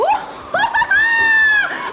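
very high, loud sustained squeal; piercing over-the-top cackle; shrieking laugh. Acted. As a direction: let out a long hammed-up shriek. Third situation, acted outburst.

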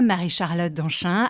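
usual speaking voice

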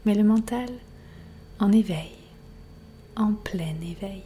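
unhurried relaxation voice, letting silence settle; guided meditation. Acted. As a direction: guide the listener gently into rest, phrase by phrase. Leads a yoga nidra relaxation.

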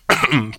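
rough cough to clear the throat. Real, not acted. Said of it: "je m'étouffe".

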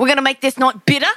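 quick botched British, sounding Australian; accidental Australian accent. Acted. Playing the English noble, botched British turning Australian. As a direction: try British and land in Australian. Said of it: "Fucking Australian".

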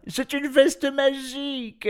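slow, very high, loud call; excited shout. A narrator's reading. Professor shouting to his wife from the garden, excited. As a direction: cry it out in amazement, drawn out high. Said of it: "lui cria le professeur".

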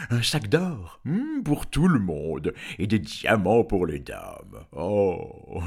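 loud, drawn-out dragon offer; eager dragon. A narrator's reading. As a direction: start high, then sink as the offer grows. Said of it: "dit vivement Chrysophylax". (dragon empressé, voix forte et traînante)

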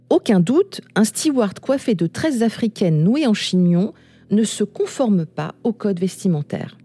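normal talking voice

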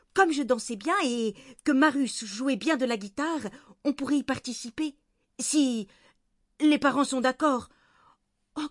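put-on high, eager child's voice; excited little girl. A narrator's reading. Granddaughter tells grandfather about the Notre-Dame show, high excited voice. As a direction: pitch it high and gush with enthusiasm.

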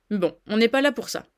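ordinary delivery

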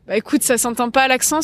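ordinary talk

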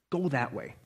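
ordinary delivery

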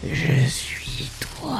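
slow unvoiced hiss; hissing creature. A narrator's reading. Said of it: "siffle la créature".

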